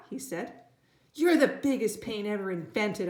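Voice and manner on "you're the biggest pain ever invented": loud angry yell at little brother; boy yelling in fury. A narrator's reading. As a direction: shout it, fed up. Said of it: "I yelled".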